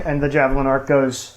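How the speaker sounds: ordinary delivery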